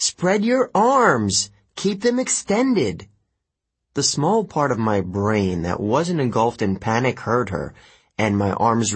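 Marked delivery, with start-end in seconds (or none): 0.1-3.1 high, slow, drawn-out shout; yelling from above. A narrator's reading. Girl yelling to a falling friend from above. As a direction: call instructions across a distance, stretching the words. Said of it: "Annabeth yelled from somewhere above me".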